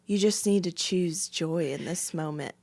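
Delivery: soft, measured, earnest advice; gently counsel, mimicking the husband; imitated calm counsel. Husband advising upset wife on the floor, imitated calm counsel.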